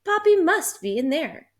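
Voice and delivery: put-on boy suddenly sure he's found Papi; excited exclaim. A narrator's reading. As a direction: cry it out with sudden hope. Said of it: "Carlito said".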